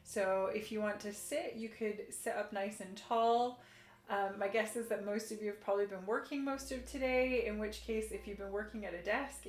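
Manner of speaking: soft, soothing class guidance; calm yoga instruction. Acted. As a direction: guide gently into stretches. Leads a gentle yoga session.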